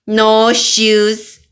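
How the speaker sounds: loud defiant yell; shouting. Acted. Toddler refusing her shoes, loud shout. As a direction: stamp it out as a tantrum.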